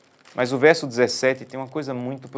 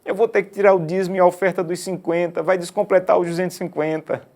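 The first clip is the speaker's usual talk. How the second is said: high, louder whiny complaint; crying child; 7 semitones higher, 4 dB louder than usual. Retold or imitated. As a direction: sob it out like a boy. Menino chorando explica. (criança chorosa, voz aguda)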